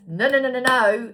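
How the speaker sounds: emphatic no. Real, not acted. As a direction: shut it down hard, driving each word. Emphatic rejection to viewers.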